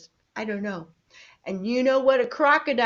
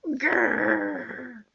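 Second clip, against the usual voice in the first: playful bear growl. Pretend. Playing with toy polar bear.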